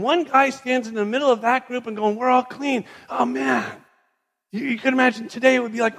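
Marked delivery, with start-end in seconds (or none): 2.2-3.7 rejoicing. Acted. Healed man rejoices to his group.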